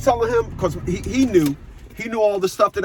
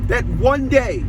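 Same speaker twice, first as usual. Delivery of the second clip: higher, stressed "one day"; 4 semitones higher, slower than usual. Real, not acted. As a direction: hit "that one day" again for weight. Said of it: "I put the emphasis on that".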